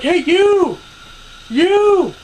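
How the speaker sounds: high, full-volume call-out; loud imitated heckling yell; Elham's yelling. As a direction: holler at every passerby, drawing out the last word. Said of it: "keeps on fucking yelling that".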